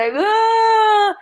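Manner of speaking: high mock cry of a scared child; half-acted wail of despair, high; recalling a child's panicked cry; retold despairing wail. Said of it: "eu fiquei desesperada".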